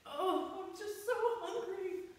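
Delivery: high, whiny hungry moan; high pleading whine for food; moaning hungry caterpillar. Acted. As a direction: moan like a starving little caterpillar. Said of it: "It's like".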